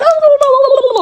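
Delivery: high-pitched rapid babble, imitating her own rant; mock angry gibberish. Vlogger showing viewers how she talks when mad, mock angry gibberish. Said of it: "you would think I'm talking in Spanish".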